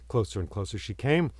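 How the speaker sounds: ordinary delivery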